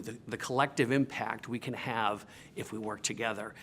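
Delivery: normal talking voice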